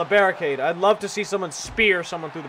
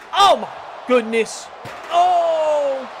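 much higher and louder sudden cry; shocked yelp; 9 semitones higher, 5 dB louder, much slower than usual. Real, not acted. Commentator seeing a chair coming, startled high yelp. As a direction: jump out of your seat in shock.

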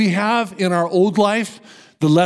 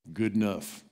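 low understated verdict; 8 semitones lower, 12 dB quieter than usual. Retold or imitated. Older man's grudging verdict.

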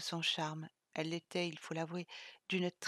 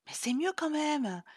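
petite voix aiguë de gamine; voix d'enfant; 7 semitones higher than usual. Acted. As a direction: jouer la gamine têtue. (Chloé répond à Sylvie, petite voix aiguë)

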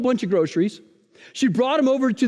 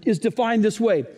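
ordinary talk

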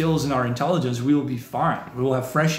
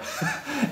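short laugh; 13 semitones higher, 7 dB quieter than usual. Real, not acted. Laughs at a viewer comment.

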